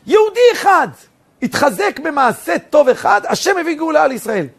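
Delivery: high, loud preacher's delivery; impassioned preaching. Real, not acted. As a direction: ring it out with full force.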